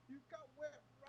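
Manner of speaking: distant, faint off-mic voice; far from mic. Real, not acted. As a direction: sound far from the microphone. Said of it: "You're so far away".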